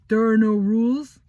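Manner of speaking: loud, high mocking quote; mocking counselor. Boy mocking what James says, loud mock tone. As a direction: throw their words back sarcastically. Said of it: "And now it's".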